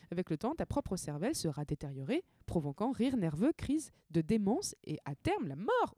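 lively mock cookbook reading; reading aloud. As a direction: read it out like a playful recipe. Reads her own guide aloud. (lecture enjouée d'une recette)

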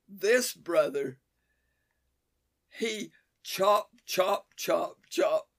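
slow loud broken English; chopping brother. Retold or imitated. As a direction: mime a boy chopping wood. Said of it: "he indicates".

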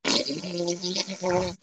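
soft, drawn-out mouth noise; imitated fart noise. Storyteller mimics the quiet bathroom stall, imitated fart noise. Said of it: "all of a sudden you hear".

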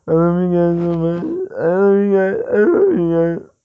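loud, high, gushing love declaration; drunk lady voice. Pretend. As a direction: gush like a blackout drunk.

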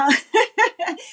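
high-pitched self-amused laugh. Real, not acted. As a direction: laugh at your own naive blunder. Laughs at own naive career move.

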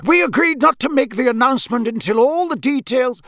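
high, loud, agitated reminder; officious objection. A narrator's reading. Official reminding colleague of agreed secrecy, officious. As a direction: cut in to stop him spilling it. Crouch objects to Bagman's hint.

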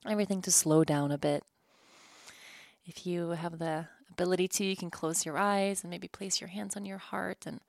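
soft, calm meditation voice; gentle breath-work guide; guided breathing. Acted.

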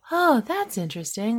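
underwhelmed, mildly vacant reaction; mock sedated monotone. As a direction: imitate an actor who sounds sedated. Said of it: "they're, like, monotone".

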